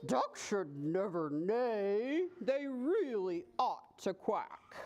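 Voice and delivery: slow, low horse voice; taken-aback horse. A narrator's reading. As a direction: reprove slowly, drawing the words out. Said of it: "He was taken quite aback".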